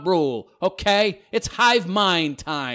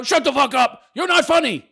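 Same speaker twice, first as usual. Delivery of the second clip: raised, high-pitched mock shout; mock angry troll; 7 semitones higher, 4 dB louder than usual. Host reading out a hostile comment, mock angry. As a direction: yell it as a fed-up heckler would.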